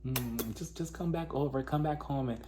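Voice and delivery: very soft, hushed wooing imitation; mock coaxing. Ex luring her back home, hushed coaxing. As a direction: coax quietly, almost under your breath.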